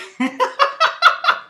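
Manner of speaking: shrill delighted cackle; high-pitched amused cackle. Real, not acted. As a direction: burst into a high laugh.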